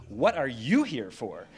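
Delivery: slow, sassy, leaning on YOU; with attitude. Shown as a demonstration. Showing the congregation how to ask each other, with attitude. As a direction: drag out "What are YOU here for?" with attitude. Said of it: "do it with some attitude".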